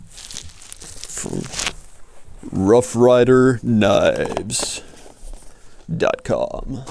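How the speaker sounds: slow, drawn-out fighting game announcer. Pretend. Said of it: "How you like my announcer voice?".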